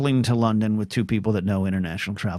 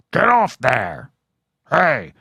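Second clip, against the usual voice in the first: hag yelling; 3 semitones higher, 4 dB louder, much slower than usual. Retold or imitated. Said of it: "hag yells from the window".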